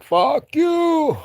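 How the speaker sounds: very high, drawn-out retold heckle; mock falsetto yell. Townspeople yelling at the musher from windows, mock falsetto yell. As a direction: hoot it out in an exaggerated high voice.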